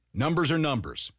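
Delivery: ordinary talk, nothing marked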